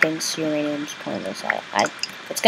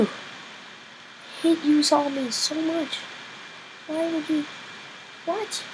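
whiny drawn-out complaint; 9 semitones higher, 8 dB quieter, much slower than usual. Real, not acted.

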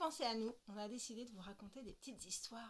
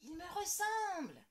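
put-on high, excited child; girl marvelling at her brother; delighted exclaim; 7 semitones higher, 5 dB louder, slower than usual. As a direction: gush over the baby. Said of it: "s'écrie Lola".

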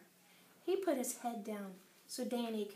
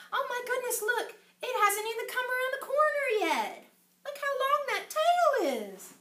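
much higher, wonder at the long tail; amazed; 11 semitones higher, 3 dB louder than usual. Real, not acted. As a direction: marvel at the picture. Teacher amazed at picture.

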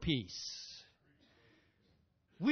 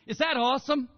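normal talking voice

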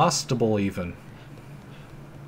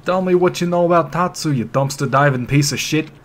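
loud, higher furious demand; angry demand; 5 semitones higher, 6 dB louder than usual. Acted. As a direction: bark the insult at him. Voicing angry protagonist.

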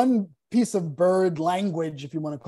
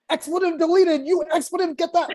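much higher, furious little bird voice; angry hummingbird subtitle; 10 semitones higher, 4 dB louder than usual. Pretend. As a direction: squeal it out like an enraged hummingbird at the feeder. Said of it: "the subtitle between hummingbirds ... is like".

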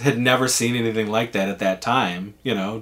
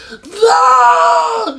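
very high, loud wailing no; mock bawling no; 8 dB louder than usual. Defeated boy wailing at his uncle, bawling. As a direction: wail it like a crushed kid.

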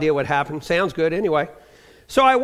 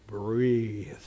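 deep baritone nurse; 9 semitones lower, 10 dB quieter than usual. Retold or imitated. Nurse telling the waking patient to breathe, deep soft baritone. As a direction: lower and much softer, one calm word. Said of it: "beautiful, deep baritone voice".

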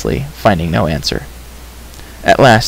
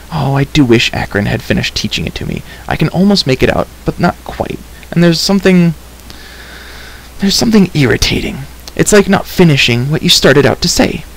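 higher-voiced girl, fretting over a puzzle; frustrated girl character; 4 semitones higher than usual. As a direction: fret aloud as an impatient young heroine.

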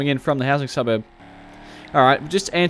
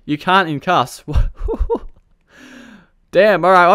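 ordinary talk, nothing marked

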